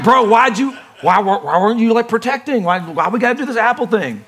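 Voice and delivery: playful griping at Adam, pitch up; mock Adam scolding. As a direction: hassle Adam like a buddy. Adam scolded at heaven's gates.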